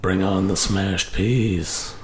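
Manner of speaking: low, slow sultry croon. Pretend. Joining the sultry bit.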